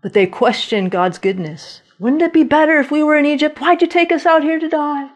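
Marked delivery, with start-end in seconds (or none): 2.0-5.1 rushed, higher grumbling; fast whiny complaint. Retold or imitated. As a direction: blurt the complaint quickly. Israelites question God.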